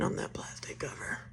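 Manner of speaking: hushed whisper while watching the dog; a soft aside about the dog. Real, not acted. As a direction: whisper it so the puppy won't hear.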